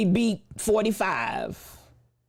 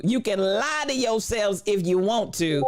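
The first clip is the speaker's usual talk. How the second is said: usual speaking voice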